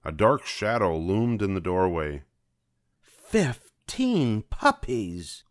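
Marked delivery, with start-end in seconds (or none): 3.0-5.4 higher, very slow greedy villainess voice; drawn-out Cruella gloat; exclaiming Cruella. A narrator's reading. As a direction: savor it like a greedy diva. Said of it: "Cruella de Vil exclaimed".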